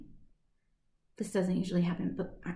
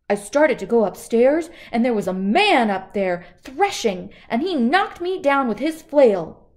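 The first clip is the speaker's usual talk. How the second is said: frightened robber; 2 semitones higher, 7 dB louder than usual. Acted. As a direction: tell it in a breathless fright. Frightened robber tells story.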